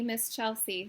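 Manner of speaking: usual speaking voice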